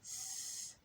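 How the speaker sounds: whispered high "س"; teacher sounding out a letter; hissed s. Shown as a demonstration. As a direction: hiss the س sound. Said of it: "نقول كلمة ثري".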